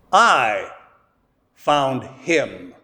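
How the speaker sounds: I set apart and drawn out, falling; stress on I. Shown as a demonstration. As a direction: lean on I, pause, then a low found him. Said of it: "the emphasis in that statement is on me".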